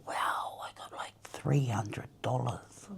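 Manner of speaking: hushed, awed whisper; amazed whisper. Retold or imitated. Whispering her amazement at her own savings. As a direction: breathe it out in disbelief.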